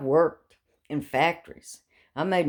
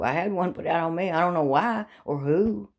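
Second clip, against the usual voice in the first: usual speaking voice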